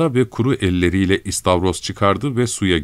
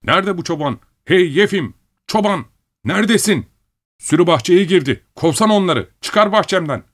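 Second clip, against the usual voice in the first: raised, high-pitched urgent yelling; calling from the shore; 11 semitones higher than usual. A narrator's reading. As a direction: holler across to someone far off. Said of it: "kıyıdan bir ses duyuldu".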